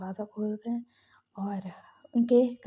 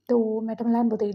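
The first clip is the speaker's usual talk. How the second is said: usual speaking voice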